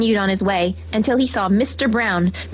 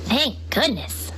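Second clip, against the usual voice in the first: slow, softer relief; relieved sigh; 3 semitones higher, 4 dB quieter, slower than usual. A narrator's reading. Henry finds the hens, softer and slow. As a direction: let the worry drain out.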